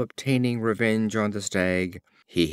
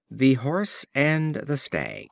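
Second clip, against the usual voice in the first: slow, measured title announcement; title read; 4 semitones higher, slower than usual. A narrator's reading. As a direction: set the title apart, slowly. Reads fable titles slowly.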